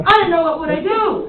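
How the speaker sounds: high, loud dramatic cry; exaggerated intonation. Shown as a demonstration. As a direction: push it up high and project it. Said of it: "One more time".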